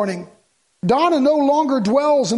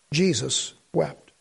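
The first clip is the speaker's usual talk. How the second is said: solemn hush; 9 semitones lower, 6 dB quieter, slower than usual. Acted. Solemn scripture climax.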